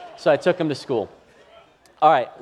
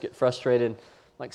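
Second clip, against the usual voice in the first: ordinary talk, nothing marked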